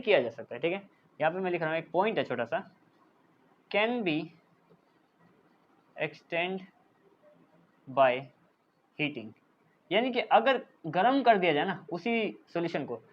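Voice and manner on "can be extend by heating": softer, in short pieces with pauses; dictating. Real, not acted. Teacher writes a small point for students, piecemeal dictation. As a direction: say each piece as you write it down.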